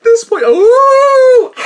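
slow, high laughing cry; howling with laughter. Real, not acted. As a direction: lose it completely laughing. Laughing reaction to the ad.

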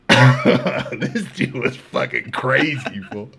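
real laughter breaking up the words; laughing. Real, not acted. Teller cracking up at his own story, laughing. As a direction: struggle through it slowly while cracking up.

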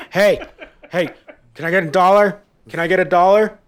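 high, loud mock begging voice; begging woman. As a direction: pester each car for a dollar.

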